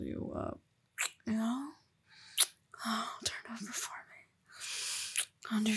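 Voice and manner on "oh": normal talking voice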